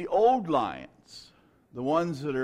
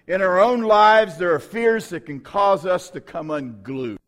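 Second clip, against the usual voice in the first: louder, higher preaching; preaching with rising force; 4 semitones higher, 8 dB louder than usual. Acted. Preacher exhorting congregation, rising sermon delivery. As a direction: urge the church on with fire.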